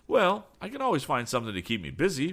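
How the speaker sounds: lightly voiced lad. A narrator's reading.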